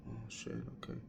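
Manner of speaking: breathy whispered shock; whispered reaction. Retold or imitated. Hearing his dad's criticism, stunned whisper. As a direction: breathe it out in dismay. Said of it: "I kinda".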